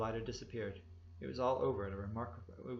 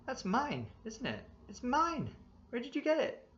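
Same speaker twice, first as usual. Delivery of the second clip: much higher, louder, rising disbelief; shocked outcry; 13 semitones higher, 5 dB louder than usual. A narrator's reading. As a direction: shout as you realise it's yours. Narrator sees his own belt.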